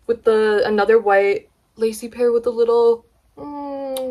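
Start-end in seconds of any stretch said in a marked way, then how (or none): none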